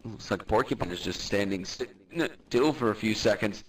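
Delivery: low, steady sports commentary; play-by-play commentary. Acted. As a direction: call the fight as it happens. Live fight commentary.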